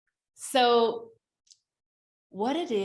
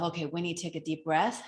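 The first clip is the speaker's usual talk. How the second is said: calming self-talk; 2 semitones lower, 6 dB quieter than usual. Retold or imitated. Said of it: "I just remind myself".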